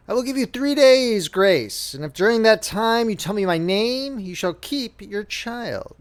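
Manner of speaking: softened, slowly granting grace; lightly voiced little man. Dwarf gives the weeping queen three days, slow and softened.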